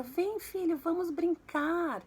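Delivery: high, slow, eager call to play; a dad sweetly inviting his son; cheerful dad. Pretend. (pai animado chamando o filho para brincar)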